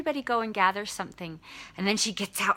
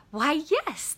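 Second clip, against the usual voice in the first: lightly voiced, higher-pitched witch; welcoming witch; 7 semitones higher than usual. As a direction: agree with a high lilt, mildly acted. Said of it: "And the witch is like".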